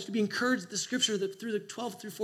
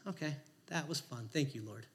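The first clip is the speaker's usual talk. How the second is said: softer, fading mock prayer under the breath; hushed prayer; 5 semitones lower, 13 dB quieter than usual. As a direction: drift off as if the sound goes away. Quiet prayer as sound fades.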